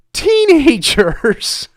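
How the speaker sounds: slow words breaking into a laugh; slowed, incredulous laughing delivery; laughing in disbelief. Real, not acted. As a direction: laugh at the absurdity as you say it. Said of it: "I'm laughing".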